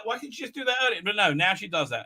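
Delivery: ordinary talk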